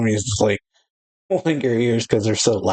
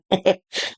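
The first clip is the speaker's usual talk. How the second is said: high giggle; 9 semitones higher than usual. Real, not acted. Giggling while reading the manga.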